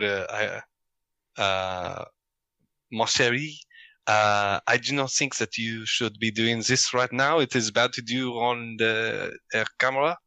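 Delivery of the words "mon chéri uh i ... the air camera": higher-pitched French woman voice; hammed-up French woman; female French accent. Shown as a demonstration. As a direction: put on a feminine French accent. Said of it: "doing a, a female French accent".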